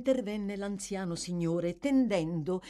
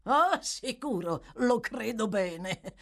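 slow, higher mock agreement; sneering; 5 semitones higher, 4 dB louder, slower than usual. Magistrate Fang answers the worried gentleman, sneering. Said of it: "con un sogghigno".

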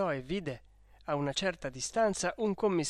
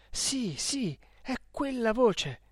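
higher-pitched, rising excitement; excited recognition; 7 semitones higher, slower than usual. A narrator's reading. Renzo recognising the voice in the hut, excited. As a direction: thrill at recognising it.

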